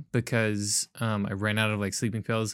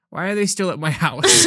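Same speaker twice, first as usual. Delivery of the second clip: laughing exasperation; 6 semitones higher, 6 dB louder than usual. Retold or imitated. Retold late-night frustration, laughing.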